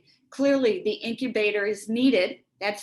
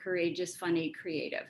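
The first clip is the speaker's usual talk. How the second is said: hushed self-soothing chant; soft murmured repetition; 4 semitones lower, 8 dB quieter than usual. Shown as a demonstration. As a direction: quietly repeat "courageous, funny, creative" under the breath, each word soft. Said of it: "So I might be saying".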